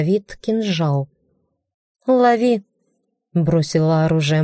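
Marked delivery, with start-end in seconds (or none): 2.1-2.6 sharp higher call. Acted. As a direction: toss it with a shout. Throwing dagger, calling out.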